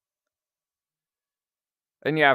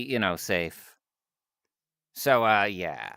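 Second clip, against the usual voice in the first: normal talking voice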